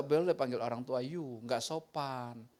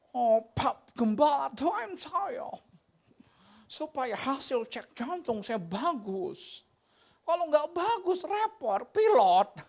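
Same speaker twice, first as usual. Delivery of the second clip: high, pleading voice of a nervous churchgoer; pleading congregant; 9 semitones higher, 4 dB louder, slower than usual. Retold or imitated. Congregant begging his pastor to pray, pleading high.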